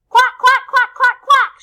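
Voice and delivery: high, very loud duck quacks; rapid-fire noisy duck call; shouted quacking. A narrator's reading. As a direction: blast out each quack at the top of the voice. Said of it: "shouts Dottie".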